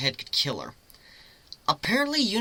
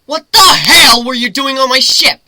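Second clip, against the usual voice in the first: loud, high furious demand; angry shout; 10 semitones higher, 15 dB louder than usual. Acted. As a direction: bark at the stowaway on your ship. Captain interrogating stowaway.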